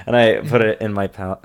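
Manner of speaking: ordinary delivery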